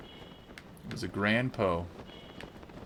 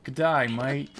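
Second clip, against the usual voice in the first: hammed-up Australian greeting, louder; mock Australian; 5 semitones higher, 5 dB louder than usual. Streamer greeting chat in a hammed-up Australian accent. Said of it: "saying random Australian stuff".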